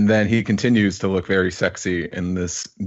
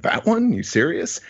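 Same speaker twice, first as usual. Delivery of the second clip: incredulous; 10 semitones higher than usual. Acted. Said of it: "Carney's is like".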